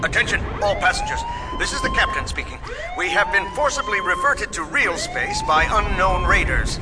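filtered ship intercom voice; captain's announcement. Acted. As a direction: announce to all passengers over the speakers. Captain's announcement over ship's speakers.

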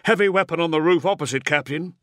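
fast, high, loud combat report; sergeant reporting. A narrator's reading. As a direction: report urgently to your captain. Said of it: "reported one of the sergeants".

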